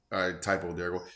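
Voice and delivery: ordinary talk, nothing marked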